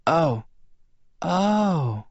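high, faint stunned woman; weak Bella voice. A narrator's reading. Bella realises.

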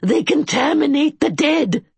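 put-on high, pleading cry of the old keeper; desperate. A narrator's reading. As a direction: beg him to see the danger. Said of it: "the man said desperately".

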